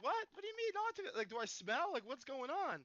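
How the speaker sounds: high-pitched baffled questions, imitating a younger self; retold bewilderment. Storyteller as fifth grader answering classmate, bewildered. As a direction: act confused and pitch it way up.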